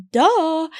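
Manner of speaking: high, loud retold duh; emphatic obvious yes. Couple answering girl offering a video, emphatic duh. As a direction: punch it high, as if it's obvious.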